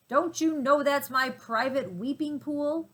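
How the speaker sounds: an angry rhino catching a trespasser; deep angry voice. A narrator's reading. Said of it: "said a deep, angry voice".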